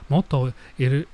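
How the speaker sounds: ordinary talk, nothing marked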